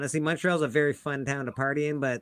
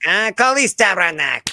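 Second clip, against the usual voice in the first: Quebec French curse, louder and higher; mock Quebecois swearing; 5 semitones higher, 9 dB louder than usual. Friends trading Quebec French swears, mock Quebecois outburst. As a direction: bark it like an angry Québécois. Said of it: "Brunswick French".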